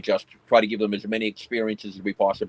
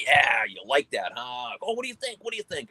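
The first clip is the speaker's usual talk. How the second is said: teasing the dogs; 3 semitones higher than usual. Retold or imitated. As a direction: egg an excited dog on playfully, pitch leaping up at the end. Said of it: "we kinda tease them and be like".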